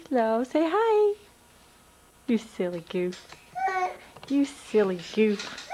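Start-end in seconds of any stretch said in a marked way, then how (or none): 2.3-3.3 softer, lower teasing murmur. Real, not acted. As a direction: tease him gently under your breath. Teasing toddler, said twice.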